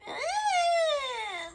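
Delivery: very high, whiny mock meow; imitating a whiny cat. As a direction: mimic the cat's pitiful whine. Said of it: "so pitiful and annoying and whiny".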